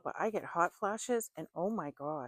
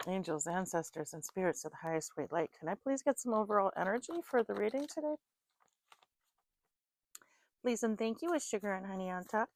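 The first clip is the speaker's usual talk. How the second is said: higher, sweet, pleading prayer voice; sing-song request to the spirits; invocation to the spirits; 4 semitones higher than usual. Acted. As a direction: call on the angels politely, lifting the pitch. Invoking spirits before the reading.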